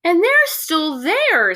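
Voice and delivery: high, slow and loud little cub voice; Baby Bear. Acted. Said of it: "said Baby Bear".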